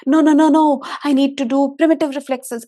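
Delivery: insistent inner voice. Retold or imitated.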